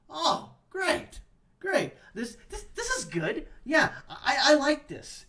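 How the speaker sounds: sarcastic delight. Real, not acted. Sarcastic praise of the quote.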